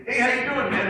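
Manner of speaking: high-pitched, friendly acted hello; upbeat greeting. Student greeting a friend at school, upbeat. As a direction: greet a pal with bounce.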